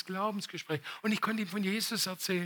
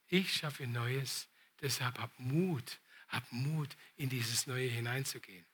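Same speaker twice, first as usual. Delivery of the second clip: low, soft, very slow exhortation; quiet encouragement; 7 semitones lower, 9 dB quieter, slower than usual. Real, not acted. Preacher closing by urging courage for the new, quiet encouragement. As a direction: close gently, leaving room between phrases.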